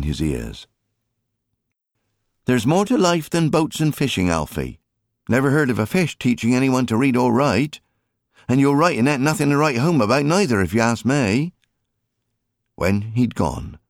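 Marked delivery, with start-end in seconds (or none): 2.4-11.5 high, brisk mother's scolding. Acted. Mary telling Alfie school matters, high scolding mother voice. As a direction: lecture the boy sharply.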